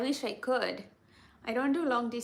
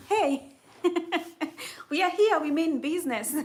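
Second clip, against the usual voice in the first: excited proud call; 6 semitones higher, 6 dB louder than usual. Retold or imitated. Voicing Kenyans calling out to tourists.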